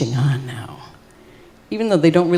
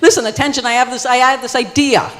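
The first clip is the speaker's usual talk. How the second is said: high, loud yell for attention; 7 semitones higher, 6 dB louder than usual. Acted. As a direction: yell over the din to be heard. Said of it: "he kept yelling at".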